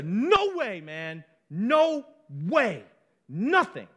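punched, passionate refusal; strong, emphatic. Shown as a demonstration. Preacher showing the congregation the real answer, emphatic. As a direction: hit NO WAY hard, like there is no doubt. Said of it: "It's strong. It's emphatic".

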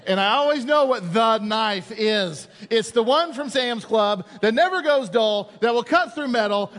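loud, fervent telling. Acted. Preacher loudly telling the congregation about the knife.

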